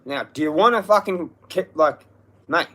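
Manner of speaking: loud, high confrontational outburst; angry threat. Real, not acted. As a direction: threaten them at full voice, building to a demand. Threatening the landlord in anger.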